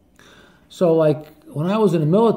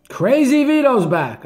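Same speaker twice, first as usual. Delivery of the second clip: higher, louder and slowed, like calling out big news; excited announcement; 9 semitones higher, 6 dB louder, slower than usual. Retold or imitated. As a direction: proclaim it to the whole street. Garbage man greets returning barber.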